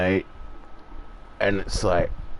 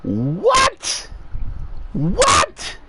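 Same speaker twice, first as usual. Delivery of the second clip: much higher, put-on baffled yelp; incredulous what; 9 semitones higher than usual. Reacting to everyone needing to be a robot, mock incredulous. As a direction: play the disbelief big, like a cartoon double take. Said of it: "And I'm like".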